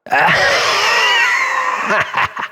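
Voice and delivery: maniacal villain laugh, high and loud; hammed-up evil laugh that gloats over Lisa; evil shouted cackle.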